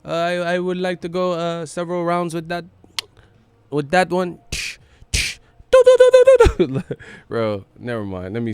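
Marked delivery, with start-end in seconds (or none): 0.0-4.4 put-on higher Filipino accent, halting; boxing talk in bed, Pacquiao-style; Pacquiao impression. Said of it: "you bring into boxing it". 5.7-7.2 high shrieking laugh. Real, not acted. Cracks up at own joke.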